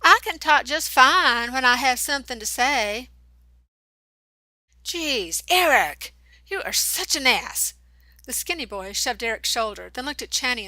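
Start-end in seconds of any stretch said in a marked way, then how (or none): 0.0-3.1 hammed-up mimicry, louder and higher; mocking high imitation. A narrator's reading. Eric mocking Channy's words in a louder, higher falsetto. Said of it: "pitched his voice up high to mock her". 4.8-7.8 exasperated scolding. A narrator's reading. Friend scolding Eric.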